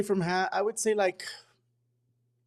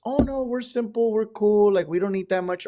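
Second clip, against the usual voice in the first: higher, breezy voice of a relaxed bride; mock easygoing bride; 8 semitones higher than usual. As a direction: play the chill bride who wants little, pitched up. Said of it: "I'll have some brides that just".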